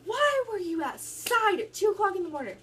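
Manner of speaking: high, loud angry mom voice. Retold or imitated. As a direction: bark it like a furious mother at 2 AM. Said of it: "mad, slinging".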